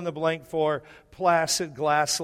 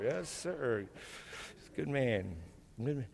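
imitating old man; 4 semitones lower, 12 dB quieter than usual. Old man voiced in story.

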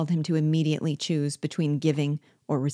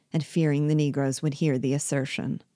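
plain reading voice

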